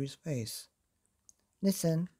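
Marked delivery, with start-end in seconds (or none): 1.6-2.1 grinning painter. A narrator's reading. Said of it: "he said, now grinning".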